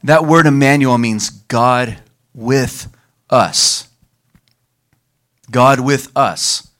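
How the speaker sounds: slow, weighty, deliberate stress on God with us; slow emphasis. Real, not acted. Preacher explaining Emmanuel to the congregation, slow and weighty. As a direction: let each word land slowly. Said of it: "slow emphatic teaching".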